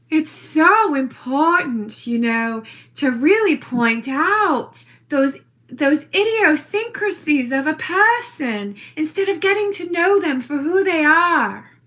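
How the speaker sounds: put-on East Hampton socialite accent; Little Edie. Lecturing on people's idiosyncrasies, in an affected East Hampton accent. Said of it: "talking like I was Edith".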